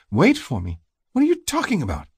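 high-pitched, incredulous woman's voice; high, disbelieving question; baffled retort. A narrator's reading. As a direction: throw it back at him, pitch climbing. She spins round, baffled retort.

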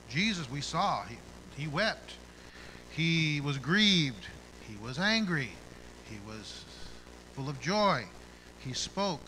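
loud, high preaching with a slow, drawn-out "He was grieved"; preaching at full force. Real, not acted. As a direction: hammer each short line to the congregation. Preaching on Jesus' emotions.

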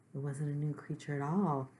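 usual speaking voice

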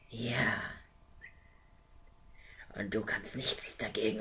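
whispered, gloating villain; sly dragon. Acted. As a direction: savour every word of the threat. Dragon monologue.

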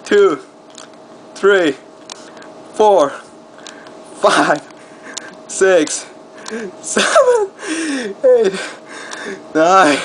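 strained counting through push-ups; doing push ups. Real, not acted. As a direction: count each rep with effort. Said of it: "Seven push-ups".

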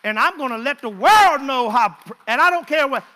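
loud, high preaching shout; full-force preaching. Acted. Preacher rallying the church, shouting high and loud.

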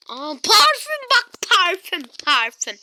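high, loud delighted cry; giddy excitement; excited find. Pretend. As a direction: squeal over a new perfume. Excited at finding perfume.

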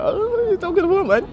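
high, bewildered stranger voice; confused stranger. Retold or imitated. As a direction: squeak it out like a lost passer-by. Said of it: "I want someone that will be like".

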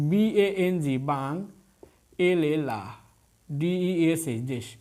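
letters first, then each syllable বাং, লা, দেশ; syllable-by-syllable demo. Shown as a demonstration. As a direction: spell and sound বাং, লা, দেশ with long gaps. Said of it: "কয়বার ভেঙে পারতেছেন".

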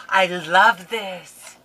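slow, loud cry of love; delighted exclaim. Real, not acted. Unboxing crafter gushes loudly over the paper. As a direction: belt it out with delight.

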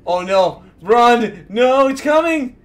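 loud, rising alarm shouts; mock panic. Acted alarm at the video.

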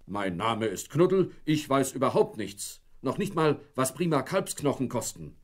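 lightly voiced, a hint of a sulky dog; a touch of hurt pride in a dog's voice; lightly voiced sulking dog; offended dog. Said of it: "Beleidigt bellte Knuddel dreimal kurz".

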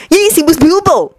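proud to have the answer; beaming. Acted. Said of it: "Ori beamed".

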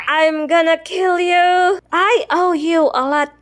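ordinary talk, nothing marked

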